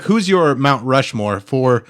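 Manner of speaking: ordinary delivery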